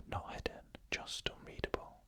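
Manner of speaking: plain reading voice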